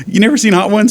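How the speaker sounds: high, incredulous rise on never; can't believe it, voice jumps up; surprised. Real, not acted. Disbelief at friend.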